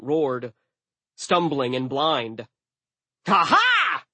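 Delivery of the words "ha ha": high, loud crow of triumph; exultant high cry; triumphant yell. A narrator's reading. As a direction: shout a gloating battle laugh. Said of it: "Jack yelled".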